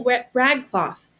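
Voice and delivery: usual speaking voice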